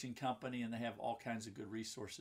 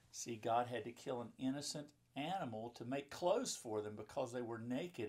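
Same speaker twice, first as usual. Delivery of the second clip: plain reading voice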